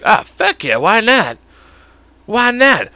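mock eager sidekick; eager yes. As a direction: toss it off like it's a great idea. Partner agreeing eagerly.

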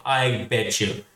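ordinary delivery

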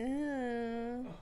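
high put-on ooh; a fake surprised ooh. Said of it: "that's such a fake ooh".

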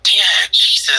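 high-pitched, exasperated retold plea; exasperated son. Son reenacting pleas to his yelling dad, exasperated.

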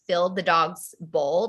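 ordinary delivery

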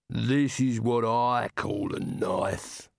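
slow, scornful giant; enormous slow giant rumble. Acted. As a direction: sneer it slowly down at him. Giant brandishes sword.

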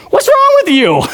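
high, exasperated self-scolding; high mock exasperation; scolding myself. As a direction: snap at yourself in mock disbelief. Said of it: "I'm thinking to myself".

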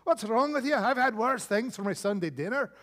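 put-on high, laughing Scottish accent brushing it off; hammed-up Scotsman in Scottish accent, scoffing. Scotsman mocking the Englishman over the fly, in a hammed-up Scottish accent. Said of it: "He's like".

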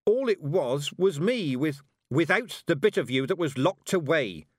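brisk, no-nonsense reproof; sharp. A narrator's reading. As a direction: correct the girl sharply. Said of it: "said Mistress Weatherwax sharply".

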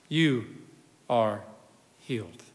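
fading from soft to near silent; soft tender blessing. Acted. Jesus gently assuring the healed woman, fading softly.

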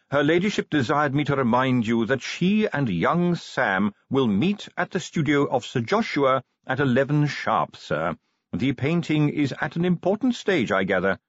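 formal butler. Acted. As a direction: read the news out like a proper manservant. Voices butler in story.

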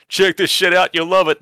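loud, high, pushy enthusiasm; hyped-up pitch. Retold or imitated. Co-host recommending a movie to a friend, hyped-up imitation. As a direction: sell it like an overeager pal.

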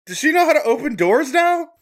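high, loud disbelief; astonished, half laughing; amazed. Real, not acted. Cat got through closed door.

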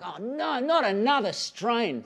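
a lightly hammed-up "oh no"; mock dismay. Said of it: "I'm like".

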